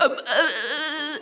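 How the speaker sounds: high, drawn-out helpless stammer; hammed-up floundering, half-laughing; mock panicked. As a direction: stall slowly on high, flustered noises. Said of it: "really panicked".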